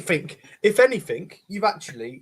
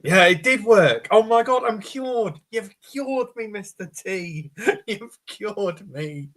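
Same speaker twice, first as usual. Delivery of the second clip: excited; 7 semitones higher, 4 dB louder, slower than usual. Acted. Character rejoices at being cured.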